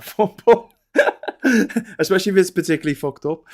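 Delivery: words breaking into laughter; laughing. Real, not acted. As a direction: giggle through the line, quickly. Laughing through the punchline.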